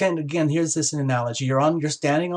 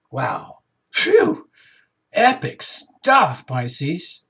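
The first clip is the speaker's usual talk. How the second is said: excited exclamation; 3 dB louder, much slower than usual. Real, not acted. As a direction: marvel out loud at the epic news. Reader blown away by the reading.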